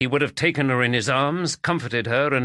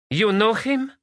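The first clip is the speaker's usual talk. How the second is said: shocked; 13 semitones higher, 5 dB louder than usual. Acted. Magdalena shocked.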